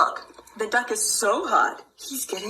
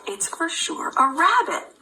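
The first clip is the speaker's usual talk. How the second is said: ordinary delivery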